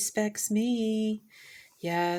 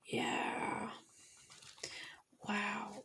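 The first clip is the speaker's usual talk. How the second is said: soft, overcome whispers; 3 semitones higher, 11 dB quieter than usual. Real, not acted. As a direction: breathe it out, too moved to speak.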